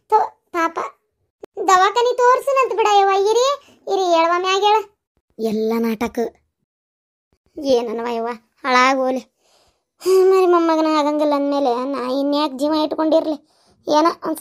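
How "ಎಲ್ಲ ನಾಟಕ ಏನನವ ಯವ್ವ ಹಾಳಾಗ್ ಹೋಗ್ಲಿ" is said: bitter despair. Acted. Grandmother gives up hope.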